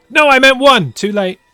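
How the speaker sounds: high, loud mock outburst; exaggerated frantic regret; mock panicked protest. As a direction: cry out like a voter who picked wrong. Mimics voter regretting their vote.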